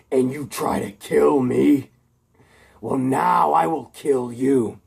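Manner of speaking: slow, menacing villain voice; villain Electro. A narrator's reading. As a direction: drag each word out like a vengeful supervillain. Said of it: "And Electro... he says".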